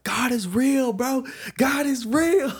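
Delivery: high, loud, overjoyed cry; ecstatic shout. Retold or imitated. To his brother on the phone after church, an overjoyed high shout. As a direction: shout the news to your brother, thrilled.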